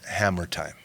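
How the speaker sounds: low, soft announcement. Retold or imitated. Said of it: "And I went".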